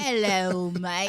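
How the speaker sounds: playful mock Australian accent. Shown as a demonstration. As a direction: greet in a put-on Australian accent. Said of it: "I thought Australia was".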